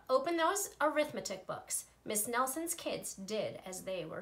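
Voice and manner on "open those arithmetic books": strict order. Acted. Strict teacher gives an order.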